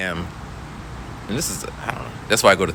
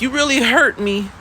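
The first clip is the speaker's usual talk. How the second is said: put-on high, hurt kid voice; mock wounded child; whiny student. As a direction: whine out the complaint. Student complaining.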